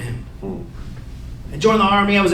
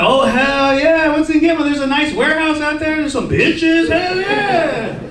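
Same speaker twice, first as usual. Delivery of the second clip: high, drawn-out hyped-up cheer; gleeful enthusiasm; 6 semitones higher, slower than usual. Retold or imitated. Soldier told he's going to Gitmo, gleeful enthusiasm. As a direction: whoop it up, pitch climbing on each phrase. Acted delight at Gitmo posting.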